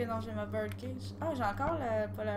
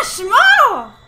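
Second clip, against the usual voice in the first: yelled much higher and louder, in a panic; panicked shout; 11 semitones higher, 22 dB louder, slower than usual. Real, not acted. Player just killed in the game shouts in panic. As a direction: scream it out as you die.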